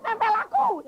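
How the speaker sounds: very high, loud screaming wife; wife screaming that the demon clings to her, high pitched. Retold or imitated. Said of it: "istri saya teriak-teriak".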